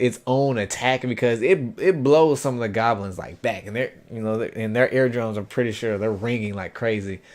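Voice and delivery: ordinary delivery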